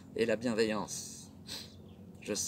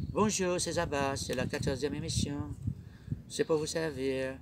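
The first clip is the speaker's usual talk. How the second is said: put-on Creole accent. Host suddenly greeting the audience as a Creole speaker, imitated Creole accent. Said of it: "j'ai envie de ne parler que créole".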